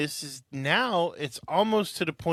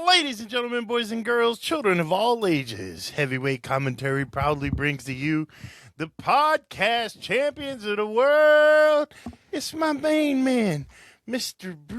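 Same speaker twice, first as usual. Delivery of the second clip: announcer voice; 7 semitones higher, 4 dB louder than usual. Acted. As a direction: ring announcer intro. Show intro announcement.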